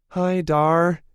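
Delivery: put-on high bright child's voice; little girl. A narrator's reading. Little Tilly greeting Darius, high child voice. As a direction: chirp it like a happy little girl.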